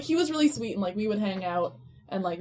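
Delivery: ordinary delivery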